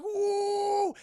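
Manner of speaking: high, loud whoop; battle whoop. Retold or imitated. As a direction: charge in with a whoop. Gargoyle charging into fight.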